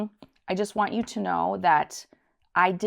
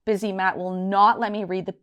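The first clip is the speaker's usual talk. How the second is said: ordinary talk, nothing marked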